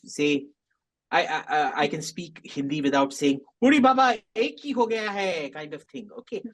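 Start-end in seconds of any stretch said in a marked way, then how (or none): 3.6-5.5 put-on Bengali accent. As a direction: exclaim it in a hammed-up Bengali accent. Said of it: "feel superior to the Bong".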